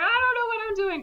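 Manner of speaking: high squeaky mock panic. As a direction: whimper it like you're in over your head. Pilot panicking while flying.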